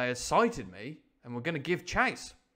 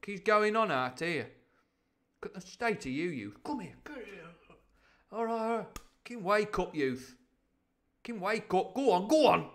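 higher, loud scolding captain; acted telling-off of a slacking hungover sailor; Captain Smollett; 7 semitones higher, 5 dB louder than usual. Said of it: "Captain Smollett coming round the corner".

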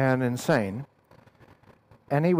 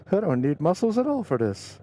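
ordinary delivery

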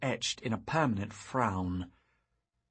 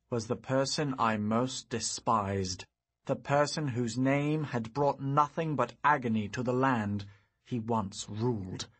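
plain reading voice

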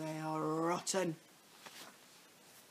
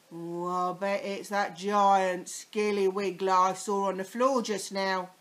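put-on sly old man; 10 dB louder than usual. A narrator's reading. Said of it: "Mister Twit said".

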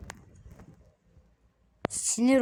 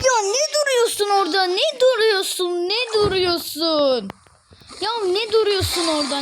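exasperated scolding; 9 semitones higher, 3 dB louder than usual. Real, not acted. Still scolding idle teammate.

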